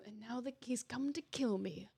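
soft, lightly acted villain monologue; Andrew Ryan. Villain facing his killer, quiet theatrical recital. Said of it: "villainy role".

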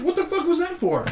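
high-pitched mock indignation; stunned protest. Man retelling being slapped by a stranger, stunned protest.

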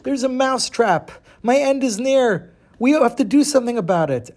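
high, urgent little mouse voice; panicked mouse. Acted. Mouse warns the chicken about the trap, high and alarmed. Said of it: "And he says".

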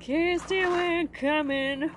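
high, stretched, playful calling out; drawn-out teasing sing-song; singsong call. Acted.

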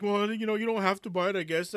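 high-pitched mock sheepish excuse; flustered retreat, pitched high; backpedaling. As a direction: imitate someone hastily walking it back. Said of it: "he started all backpedaling, all kind of freaking out".

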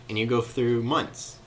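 usual speaking voice

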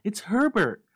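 high, loud, slow cry; desperate cry. A narrator's reading. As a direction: cry out for your son. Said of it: "she cried".

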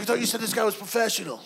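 lightly mock a skeptical producer; doing Larry's voice. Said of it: "I decided you sound like that".

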